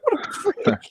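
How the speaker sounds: very high, laugh-filled retold exclamation; laughing disbelief. Younger self finding his brother gone, retold in high laughter. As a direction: squeal it through laughter.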